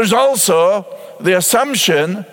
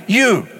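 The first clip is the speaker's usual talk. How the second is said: a single You set apart and hit; emphatic you. Shown as a demonstration. Preacher pointing the word at the congregation, emphatic You. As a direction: land the word You alone, firmly. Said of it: "It's emphatic".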